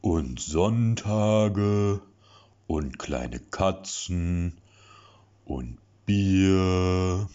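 put-on slow, drawn-out babyish list; cheerful singsong. A narrator's reading. Lasse listing things he likes, slow babyish singsong. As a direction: croon each item happily.

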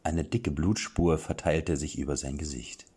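normal talking voice

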